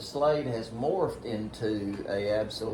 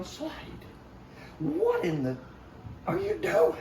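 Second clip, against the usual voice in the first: angry at son. Retold or imitated. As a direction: chew your son out in the field. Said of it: "really angry".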